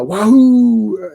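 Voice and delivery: long, slow, stretched-out cheer; drawn-out hammed-up yee-haw; mock cheer. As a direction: whoop it up like a cowboy. Cheering the action.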